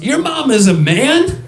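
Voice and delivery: high, drawn-out astonished question; imitated disbelief, rising at the end; incredulous dad. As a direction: butt in, baffled by what you heard. Dad jumps into acted quarrel.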